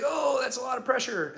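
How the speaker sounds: high-pitched imitation of wife feeling pressure; retold startled reaction.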